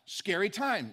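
ordinary delivery

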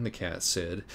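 ordinary delivery, nothing marked

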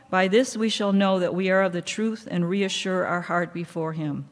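usual speaking voice

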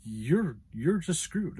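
dismissive shrug of a voice, exaggerated in retelling; mock matter-of-fact. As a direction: brush them off, mockingly. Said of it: "It was this matter of fact".

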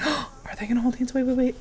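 usual speaking voice

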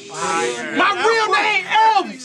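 My real name Elvis, each word dragged out; slowed-down punchline repeat; very slow emphatic repeat. Acted. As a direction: stretch the line out for the crowd to catch it. Rap line repeated for emphasis.

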